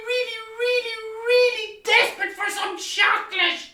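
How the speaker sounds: put-on high, fast childish begging; squeaky, rushed kid's voice begging for eggs; pleading children. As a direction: plead like a little kid desperate for chocolate. Children beg the Easter Bunny.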